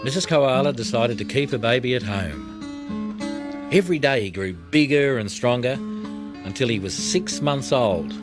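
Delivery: ordinary delivery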